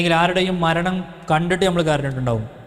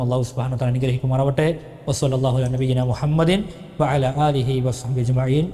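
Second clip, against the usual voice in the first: closing prayer; 4 semitones lower than usual. Acted.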